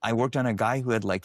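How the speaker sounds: ordinary delivery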